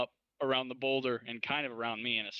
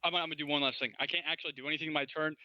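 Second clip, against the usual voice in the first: usual speaking voice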